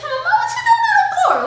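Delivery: put-on high, laughing teen voice; joking daughter. Daughter finds mom on the floor, high laughing tease. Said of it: "just making a joke".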